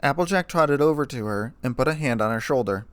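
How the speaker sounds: ordinary delivery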